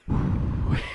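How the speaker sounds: voiceless puking noise; mock vomiting. Drunk comic puking into the microphone, imitated retch. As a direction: imitate throwing up mid-joke.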